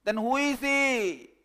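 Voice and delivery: loud high question; shouting from a distance, loud and high. Acted.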